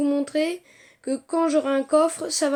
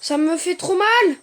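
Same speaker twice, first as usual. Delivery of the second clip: loud, higher cry of complaint; pained outburst; 5 semitones higher, 7 dB louder than usual. Real, not acted. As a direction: cry out at taking a hit. Encaisse une attaque en jeu. (cri de plainte aigu)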